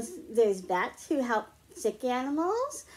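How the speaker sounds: ordinary delivery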